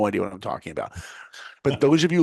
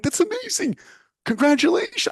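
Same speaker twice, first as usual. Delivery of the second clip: excited congratulations; 4 dB louder than usual. Retold or imitated. Friend got Disney internship.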